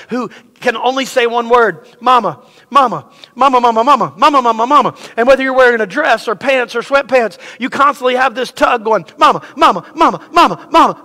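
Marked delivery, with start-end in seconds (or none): 2.0-4.9 put-on nagging toddler. Toddler nagging his mom. 9.2-11.0 put-on nagging toddler. Toddler tugging on mom.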